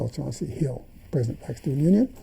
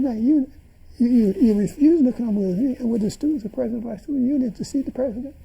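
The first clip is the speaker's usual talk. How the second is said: incredulous scolding; 10 semitones higher, 3 dB louder, faster than usual. Retold or imitated. Scolding Larry, retold.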